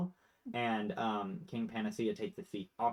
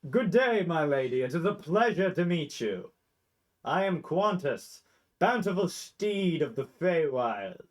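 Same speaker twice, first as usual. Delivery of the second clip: high, loud, courtly proclamation; grandiose blink dog; 6 semitones higher, 7 dB louder than usual. Acted. Blink dog Qantas introducing himself to a lady, grandiose. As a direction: announce yourself grandly, stretching the title. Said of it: "the long snoot and goes".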